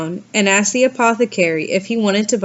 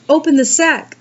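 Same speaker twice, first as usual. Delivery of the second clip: higher call from inside the sack; shouted; 6 semitones higher, 4 dB louder than usual. A narrator's reading. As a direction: yell for the drover to open it. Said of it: "little Klaus shouted".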